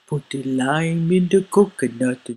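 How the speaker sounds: sing-song island voice; Jamaican accent. Pretend. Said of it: "I can do that".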